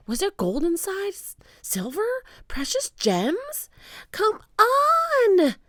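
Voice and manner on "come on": very high, loud urgent call; high loud girl's shout. A narrator's reading. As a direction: shout from the treehouse. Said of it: "Annie was shouting".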